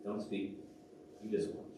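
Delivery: soft, low, hushed command; God speaking quietly. Retold or imitated. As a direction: hush him gently, leaving long pauses. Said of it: "it was like God was saying".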